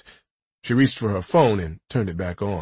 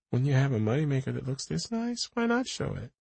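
higher, teasing woman's voice; playful woman's voice; 6 semitones higher, 6 dB quieter than usual. A narrator's reading. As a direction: tease him, proud of it. Rachel voiced in dialogue.

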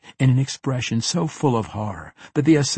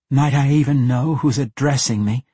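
lightly voiced captive woman, higher and louder; raised, louder voice for a cornered woman; daring question; 5 semitones higher, 7 dB louder than usual. A narrator's reading. Captive woman to the unseen man behind her, daring question.

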